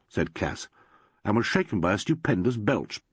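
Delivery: ordinary delivery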